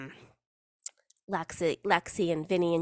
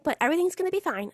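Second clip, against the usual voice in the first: quick, high imitation of a niece; pitched-up niece, rattled off quickly; 10 semitones higher, faster than usual. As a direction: lightly voice a child seeking reassurance. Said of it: "She's like".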